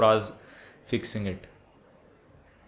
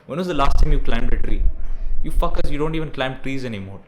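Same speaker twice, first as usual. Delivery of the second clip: loud exasperated shout, real; shouting at viewers; 9 dB louder than usual. Real, not acted. Ranting vlogger shouts at viewers who never climb trees, loud and exasperated. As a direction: yell it at the lazy audience.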